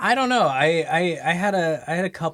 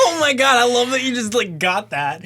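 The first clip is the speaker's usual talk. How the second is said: real delight; 8 semitones higher than usual. Real, not acted. Delighted that friend guessed the name, excited. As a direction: burst out thrilled at the guess.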